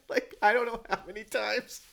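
high, laughing through words. Real, not acted. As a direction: laugh as you speak. Laughing at own discovery.

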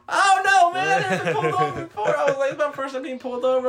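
high, loud, laughing panic; nervous panic. Retold or imitated. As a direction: blurt it like a panicked teen. Retelling nervous self to cop.

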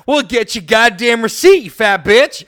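loud, high furious shout; angry clerk. Acted. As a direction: yell it at the rude customer. Acted clerk snapping at customer.

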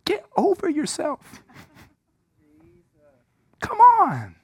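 high, teasing push to get over yourself; God's playful rebuke. Retold or imitated. God nudging the preacher to drop his pride, high. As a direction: coax him with a grin. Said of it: "God said".

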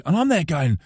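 usual speaking voice

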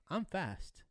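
normal talking voice

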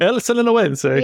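El Sinaloense said with a high Italian lilt; Italian accent. Pretend. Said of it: "with an Italian accent".

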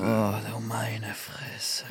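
slow, unvoiced whisper of disbelief. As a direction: breathe it out, appalled. Reagiert auf schlechten Wortwitz. (fassungslos geflüstert)

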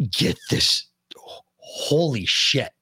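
slow, breathy awed whisper. Real, not acted. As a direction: whisper it in awe. Savouring the steak memory.

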